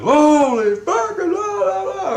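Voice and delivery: very high, loud imitation of exasperation; mock outburst. J C reacts to the self-doubt claim, mock outburst. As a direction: squeal out a drawn-out mock rant.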